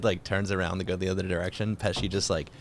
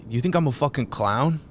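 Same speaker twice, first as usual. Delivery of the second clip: higher, louder mock menace; Pesci's menace; 6 semitones higher, 6 dB louder than usual. Gangster confronting a laughing friend, menacing. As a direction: lean in like you might snap. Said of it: "Remember the thing where he's like".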